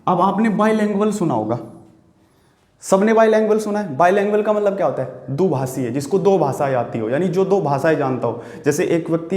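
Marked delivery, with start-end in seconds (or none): none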